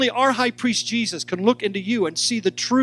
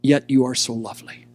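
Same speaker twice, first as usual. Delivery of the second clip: low, hushed voice of the King; the King whispering; 6 semitones lower, 4 dB louder than usual. Acted. King tells the bride she is lovely, low and tender. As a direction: lower the voice as the King speaking into her ear. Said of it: "he whispers in her ear".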